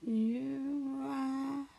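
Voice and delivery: usual speaking voice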